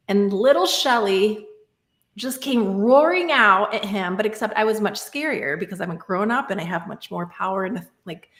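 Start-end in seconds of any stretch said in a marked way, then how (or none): none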